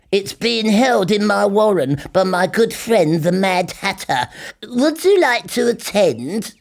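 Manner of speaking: a friendly host inviting a guest; high cheerful rabbit voice. A narrator's reading.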